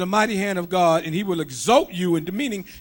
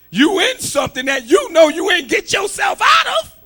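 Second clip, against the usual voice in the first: loud, high preaching shout; shouted sermon line; fiery preaching; 12 semitones higher, 10 dB louder than usual. Acted. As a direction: drive the point home. Preaching climax.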